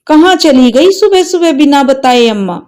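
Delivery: put-on a child who doesn't understand yet; innocent child. Grandson asks where mother went, light puzzled boy voice.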